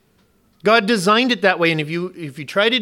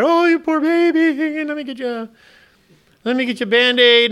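mock fawning wife; 7 semitones higher than usual. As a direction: coo over the hurt child in a high mock voice. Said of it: "my wife is fawning over my daughter".

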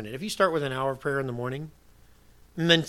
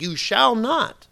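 loud, high, slow "You shall not"; very emphatic; 7 semitones higher, 6 dB louder, much slower than usual. Retold or imitated. Preacher showing how emphatic Jesus was, loud and commanding. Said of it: "He's very emphatic".

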